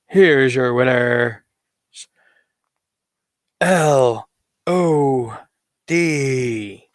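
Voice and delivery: slow, stretched winner announcement; ring announcer. Pretend. Announcing LOD as winners, drawn-out ring announcer. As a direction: draw out each letter of the team name.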